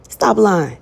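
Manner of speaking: loud, falling scold; loud preacher's rebuke; firm stop lying. Acted. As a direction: call them out, firm and flat. Calling out a liar.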